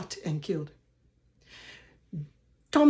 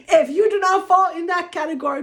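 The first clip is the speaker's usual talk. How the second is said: laughing; 8 semitones higher, 5 dB louder than usual. Real, not acted. Warning off non-Liberian viewers.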